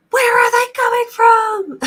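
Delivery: high, loud panicked shriek; frantic cry. Retold or imitated. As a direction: shriek it like you're losing your mind. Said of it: "freaking out".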